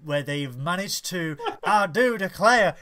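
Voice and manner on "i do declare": put-on Southern gentleman accent.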